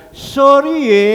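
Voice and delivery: loud, slow drawn-out sorry ye; mock refusal. Pastor playfully refusing his doctor friend, loud and slow. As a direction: wag a finger as you refuse. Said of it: "saya bilang".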